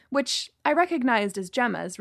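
plain reading voice

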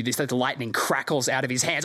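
usual speaking voice

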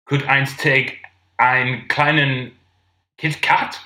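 put-on halting, word-by-word German accent; Mister Bean-like German. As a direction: pick through it as an awkward German customer. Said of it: "you sounded like Mister Bean".

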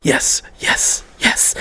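imitating a friend practising in a whisper; voiceless, repeated hushed yeses; whispered rehearsing. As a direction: whisper each yes separately, practising. Rob rehearsing answer, retold.

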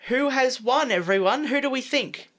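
loud, lifted host voice; game show host. Acted. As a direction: announce it to the room. Host announcing the winner.

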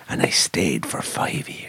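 whispered, slow and low. Real, not acted. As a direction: whisper it like a secret.

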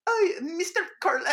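high, failed fake French accent through laughter; hint of hammed-up French accent. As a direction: attempt a teacher's fake French, cracking up. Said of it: "do a fake French accent".

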